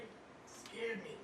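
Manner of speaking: hushed slow repeat. Shown as a demonstration. Comic repeating to the crowd how the doctor spooked him, hushed and slow. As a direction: let the words sink, slow and soft. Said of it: "So it scared me".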